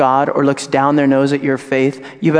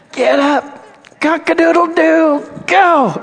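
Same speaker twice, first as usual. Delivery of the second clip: much higher, rooster crow to rouse her; dad rousing his sleeping daughter, hammed-up rooster call; wake-up call; 14 semitones higher, 3 dB louder, slower than usual. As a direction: call her awake like an alarm. Dad waking a napping daughter.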